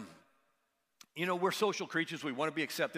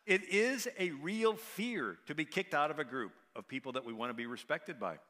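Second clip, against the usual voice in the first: ordinary delivery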